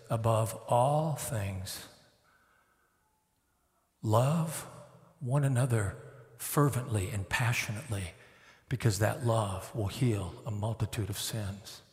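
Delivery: slow, soft reverent verse; solemn scripture reading. Acted. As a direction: read it like holy words. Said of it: "reading scripture slowly".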